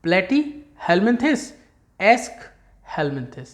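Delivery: platyhelminthes and Aschelminthes said slowly, one after the other; slow side-by-side recitation of both names; slow contrasting drill. Shown as a demonstration. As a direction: stretch each name out to compare them. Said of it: "देखो एक जैसे लग रहे हैं".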